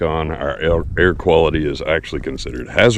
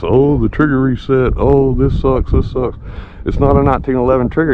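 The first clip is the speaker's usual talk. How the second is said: mocking whiny complaint; 8 semitones higher than usual. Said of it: "shit on the gun ... started making all this".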